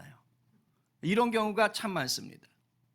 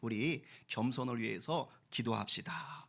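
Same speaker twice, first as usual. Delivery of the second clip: gentle Moody; 6 semitones lower, 6 dB quieter than usual. Retold or imitated. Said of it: "이렇게 말합니다".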